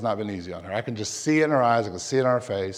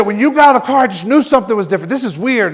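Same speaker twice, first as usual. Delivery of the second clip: high, loud, animated retelling; excited; 7 semitones higher, 6 dB louder, faster than usual. Retold or imitated. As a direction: gush it as an amazed onlooker, imitating. Retells girl's excited reaction.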